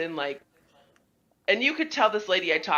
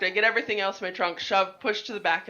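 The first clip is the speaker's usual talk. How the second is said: ordinary talk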